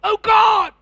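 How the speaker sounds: very high, loud cry of alarm; full-voiced scream imitating a shocked wife; wife's shout. Preacher re-enacts his wife during the robbery, shouted. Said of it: "my wife shouted".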